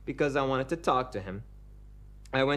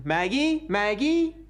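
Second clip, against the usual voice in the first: high, loud sing-song calling of a name; a husband calling sweetly through the house; calling out to wife; 9 semitones higher, 6 dB louder, much slower than usual. Acted. Husband calls his wife from the kitchen doorway, high sing-song call.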